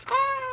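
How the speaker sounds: high yelp of alarm; startled shriek. Acted. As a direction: squeal in sudden fright. Gerald shrieks at the bird.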